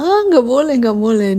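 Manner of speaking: playful retold protest, jumping high and loud; mock objection. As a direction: imitate a mother forbidding it, starting high. (pura-pura melarang)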